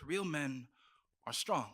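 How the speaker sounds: ordinary delivery, nothing marked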